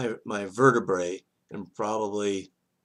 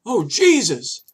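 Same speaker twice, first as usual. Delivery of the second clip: loud, much higher cry of "Oh, Jesus."; shouted "Oh, Jesus." while tumbling; retold yell; 8 semitones higher, 7 dB louder, slower than usual. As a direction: cry out to Jesus mid-fall. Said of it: "I yelled".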